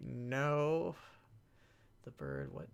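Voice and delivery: ordinary delivery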